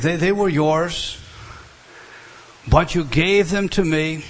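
ordinary talk

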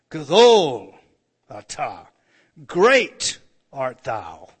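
loud, slow, drawn-out reading of "Gadol atah, great art thou"; solemn loud proclamation of "Gadol"; intoned scripture. Acted.

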